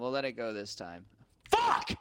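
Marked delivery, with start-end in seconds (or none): 1.5-1.9 sudden loud high curse; angry outburst. Real, not acted. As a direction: blurt it out in frustration. Gaming outburst.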